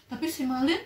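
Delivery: ordinary delivery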